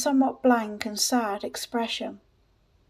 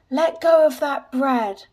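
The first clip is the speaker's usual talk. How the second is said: slow, higher, louder order; angry demand; 4 semitones higher, 6 dB louder than usual. A narrator's reading. Seller to the boy clutching bread, angry demand. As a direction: stretch each word as a forceful command.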